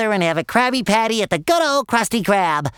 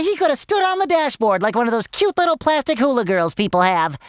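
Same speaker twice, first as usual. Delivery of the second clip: ordinary talk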